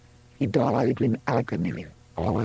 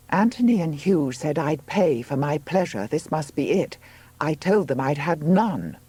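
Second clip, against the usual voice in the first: put-on higher-pitched aggrieved young woman; labouring Juno, complaining; 6 semitones higher than usual. Woman in labour bath, complaining of no pleasure. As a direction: lift the voice high for her protest.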